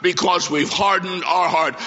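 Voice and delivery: ordinary delivery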